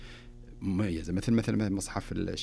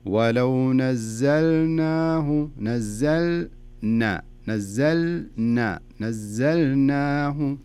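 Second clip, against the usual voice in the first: very slow, each syllable of "نزلناه" drawn out; slow demo; 3 semitones higher, 4 dB louder, much slower than usual. Shown as a demonstration. Sheikh slowing the verse down so the student can copy it, very slow. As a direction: spell the word out slowly for the student. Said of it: "جيبها بطريقة بطيئة شوي".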